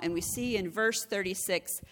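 ordinary talk, nothing marked

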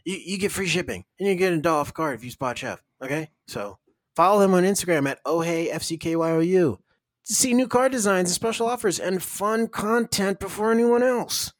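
high upbeat announcer pitch; ad read. Acted. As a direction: push the promo code like radio. Ad read call to action.

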